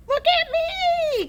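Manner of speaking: very high, slow, loud granny shriek; Grandma shouting. A narrator's reading. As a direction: demand attention from the rooftop. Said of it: "Grandma shouted".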